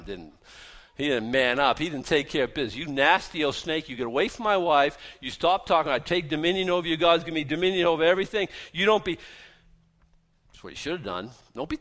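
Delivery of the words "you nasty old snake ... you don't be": loud, high tirade at the snake; raised voice, commanding it off; indignant rebuke. Acted. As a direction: rebuke the snake with full authority. Acted rebuke Adam should give.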